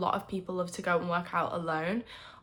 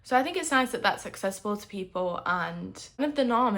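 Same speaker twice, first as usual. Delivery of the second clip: ordinary talk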